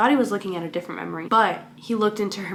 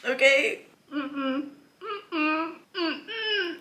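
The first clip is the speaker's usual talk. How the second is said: holding back tears; 5 semitones higher, much slower than usual. Real, not acted. Reader to her viewers at a goodbye scene, whimpering. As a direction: fight the tears with closed lips. Reacting to a sad farewell.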